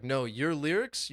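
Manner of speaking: ordinary talk, nothing marked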